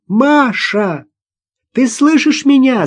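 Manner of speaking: high, urgent shout. A narrator's reading. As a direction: shout to a child out of reach. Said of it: "закричала Наташа". (громкий высокий крик)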